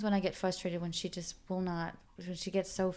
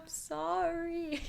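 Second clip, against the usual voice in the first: slow, higher, tearful sorry; tearful apology; 10 semitones higher, 4 dB quieter, much slower than usual. Retold or imitated. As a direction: let it break as you cry. Crying mother apologizing to baby.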